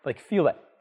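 normal talking voice

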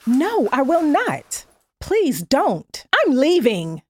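loud and slow, refusing firmly; firm loud refusal. Acted. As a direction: shut him down hard. Woman refuses producer's advance.

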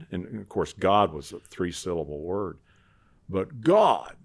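normal talking voice

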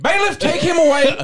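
high, loud, stammering judge voice; pompous judge thundering from the bench; blustering judge. Acted. Mock trial, judge ruling.

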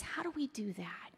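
hushed rhetorical whisper. Real, not acted. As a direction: drop to a whisper for the question. Rhetorical question to the audience.

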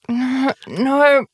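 high puzzled no. Retold or imitated. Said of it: "She's like".

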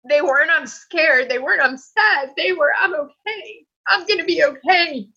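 high, emotional voice close to crying; tearful. Real, not acted. Patient describing her tears on the operating table, tearful. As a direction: let the feeling break through.

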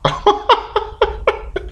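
helpless high laughter; high giggling laugh. Real, not acted. Podcaster failing to say the word, giggling laugh. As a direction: burst into high, breathless giggles. Cracks up at own pronunciation.

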